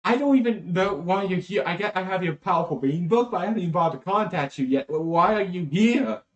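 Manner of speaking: exasperated Slav. Acted. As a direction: blurt it out in baffled frustration. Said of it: "And Slav's like".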